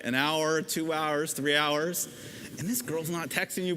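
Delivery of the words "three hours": ordinary talk, nothing marked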